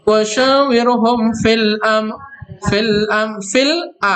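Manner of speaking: wasyawirhum fil amr chanted with a clear lam; slow Quranic chant; clear lam recitation. Shown as a demonstration. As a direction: recite slowly, sound the lam. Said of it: "alif lam qamariyah".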